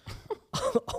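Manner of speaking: sudden high-pitched laugh; burst of high laughter. Real, not acted. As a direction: laugh out, short and bright. Laughing at own remark.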